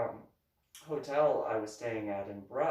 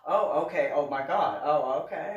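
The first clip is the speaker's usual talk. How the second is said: loud stunned reaction; 9 dB louder than usual. Retold or imitated. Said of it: "And he was just".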